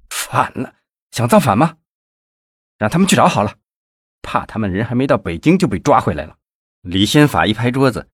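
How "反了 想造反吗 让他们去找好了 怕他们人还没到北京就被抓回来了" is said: scornful anger. Acted. Official scoffs at the protesters.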